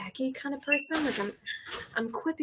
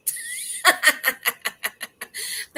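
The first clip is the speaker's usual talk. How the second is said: loud laugh; 11 semitones higher, 6 dB louder than usual. Real, not acted. Laughing at own line.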